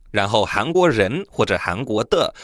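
ordinary talk, nothing marked

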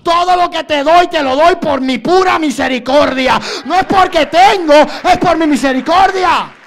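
loud, high-pitched, forceful divine rebuke; God rebuking. Acted. Preacher as God answering his demanding child, forceful rebuke. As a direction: thunder back as God correcting a child. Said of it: "y Dios te dice".

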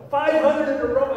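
loud, high disbelief; astonished. Retold or imitated. Pastor retelling hearing of five hundred straight hits, shocked. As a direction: blurt out the number in amazement.

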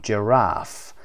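Giraffe with RAFFE stressed and falling; second-syllable stress. Shown as a demonstration. Teacher repeats the word after naming its stress, RAFFE stressed in Giraffe. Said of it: "قسمت دوم".

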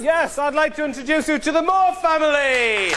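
big booming game show introduction; announcer voice. Acted. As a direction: announce the contestant with fanfare. Game show host announcing contestants.

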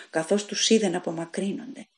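usual speaking voice

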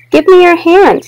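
put-on eager child. Chester grinning at mother.

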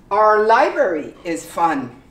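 "Our" as a short R sound; r-colored our. Shown as a demonstration. Teacher modelling "Our" for learners. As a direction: drill it, "Our" without the O U. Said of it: "You do completely without the O U".

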